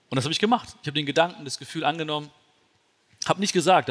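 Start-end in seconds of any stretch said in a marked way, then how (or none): none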